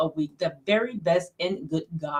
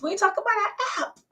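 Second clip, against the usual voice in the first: teasing high call-out; 13 semitones higher, 3 dB quieter, faster than usual. Real, not acted. Teasing co-host about app.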